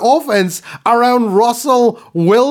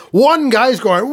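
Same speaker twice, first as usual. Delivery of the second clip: ordinary delivery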